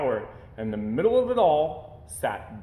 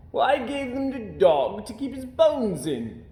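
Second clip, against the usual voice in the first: a sailor mildly puzzled; 11 semitones higher, 4 dB louder than usual. A narrator's reading. Sailor voiced.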